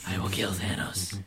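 mock a deep, emotionless action voice; Nebula. Imitating the android assassin's threat, low and slow.

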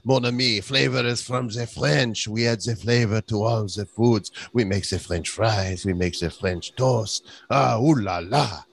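hammed-up French accent, nasal ooh la la; mock French accent. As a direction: play a snooty Frenchman lecturing on flavor.

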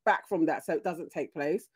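usual speaking voice

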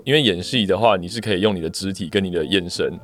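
usual speaking voice

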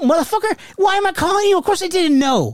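high-pitched indignant outburst; retold exasperation. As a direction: snap back at a clueless cousin, voice way up. Retold exasperated reply to cousin.

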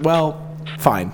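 normal talking voice

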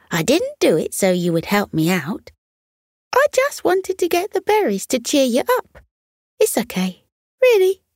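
high, quick little squirrel brushing it off. Acted. Squirrel telling the fox he needs no repayment, high and quick. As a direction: squeak it up high and a bit fast, modest.

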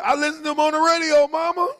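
high, quick mock protest; retold reply to mama. Son answering mama about the old player, high quick retort. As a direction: pipe up high and fast to mama. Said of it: "and I said".

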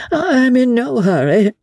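pleasant, carefree high-pitched aristocrat; pleasant fop. Acted. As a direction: toss it off lightly like a lazy dandy. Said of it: "continued Percy pleasantly".

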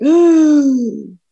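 high, loud exclamation of dismay; dismayed ooh. Retold or imitated. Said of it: "but I was like".

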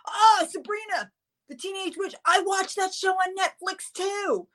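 high-pitched mock-excited cop; gushing cop impression. Cop to storyteller about a TV show, mock-gushing. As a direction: put on a high voice, drawing out the end. Cop teasingly recognizes the phrase.

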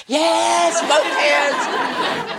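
triumphant whoop of freedom; joyful shout. Retold or imitated. Joyful shout when husband leaves.

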